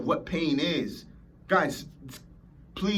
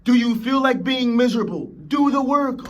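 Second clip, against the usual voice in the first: loud, high yelled challenge; angry shout; 8 semitones higher, 7 dB louder than usual. Real, not acted. Coach shouting back at someone's lame excuses. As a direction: hammer them with the question and order.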